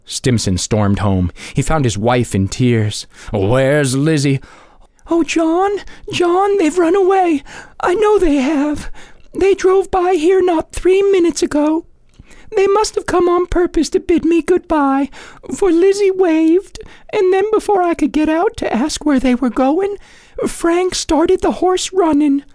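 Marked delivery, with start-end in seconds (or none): 3.3-4.4 slow, stern father's demand. A narrator's reading. Stimson demands angrily. 5.1-22.4 very high, distraught wife's voice; tearful wife. A narrator's reading. Wife in tears, panicking.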